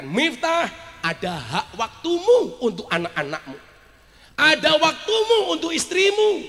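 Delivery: stern reminder building high and loud; Allah admonishing. Acted. God reminding Miftah of time for his family, stern admonishing. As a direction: build from a call to a ringing admonition. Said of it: "Seolah-olah Allah mengingatkan".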